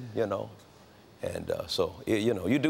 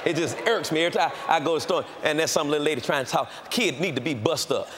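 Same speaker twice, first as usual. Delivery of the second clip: usual speaking voice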